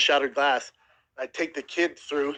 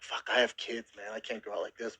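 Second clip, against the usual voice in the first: inner thought; 4 semitones lower, 9 dB quieter than usual. Retold or imitated. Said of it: "In my head, I'm like".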